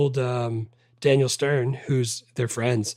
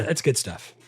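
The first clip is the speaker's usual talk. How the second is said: normal talking voice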